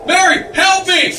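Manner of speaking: a frantic cry for his wife; desperate shout. Acted. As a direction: yell her name in panic. George calls desperately for Mary.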